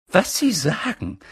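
high, urgent voice of an agitated stern professor; stern witch teacher. A narrator's reading. Professor McGonagall pressing Dumbledore for news, high and urgent. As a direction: press for gossip like an anxious headmistress.

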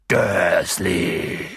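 tief und laut dröhnend. A narrator's reading. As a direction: lass den Namen dröhnen. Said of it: "dröhnte er". (Hagrid dröhnt Dursley an, dröhnend)

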